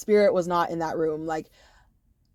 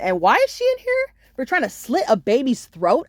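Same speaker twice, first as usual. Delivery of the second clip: outraged; 5 semitones higher, 3 dB louder than usual. Retold or imitated. Outraged complaint to guards.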